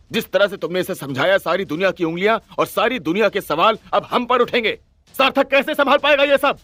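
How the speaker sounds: high, agitated outburst; upset, high-pitched protest; troubled angry shout. A narrator's reading. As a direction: cry out in frustrated anger, pitch climbing. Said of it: "परेशान और गुस्से से भरे मन से चिल्लाया".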